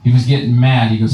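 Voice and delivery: ordinary delivery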